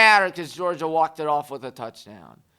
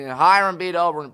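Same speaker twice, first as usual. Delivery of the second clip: ordinary delivery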